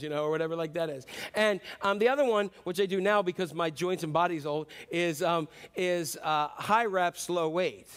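normal talking voice

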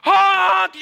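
loud high honk like a car horn; mock horn honk. Pastor imitating a car horn at a stalled driver, loud honk. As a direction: blare it like an angry driver. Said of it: "You wanna go".

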